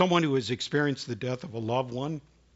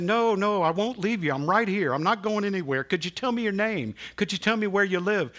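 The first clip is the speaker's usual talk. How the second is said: reassuring and urgent; 7 semitones higher, 4 dB louder than usual. Retold or imitated. Reassuring frightened girl, urgently.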